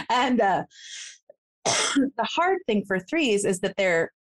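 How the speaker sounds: usual speaking voice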